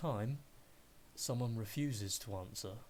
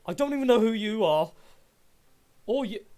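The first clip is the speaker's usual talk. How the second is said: high, loud frightened outburst; panicked shouting; 11 semitones higher, 14 dB louder than usual. Acted. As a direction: shout at strangers in alarm. Panicked character confronts strangers.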